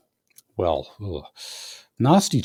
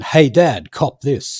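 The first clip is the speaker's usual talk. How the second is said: louder, higher call-out; mock taunting call; 7 semitones higher, 6 dB louder, slower than usual. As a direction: imitate a jeering heckle. Acting out prisoners taunting a man.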